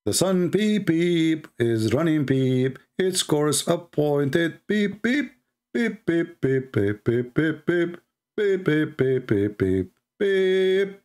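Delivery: squeaky little peeps chopped into the verse; mock beeping. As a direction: ridicule the reading with toy-horn peeps. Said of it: "This is what the Quran is saying".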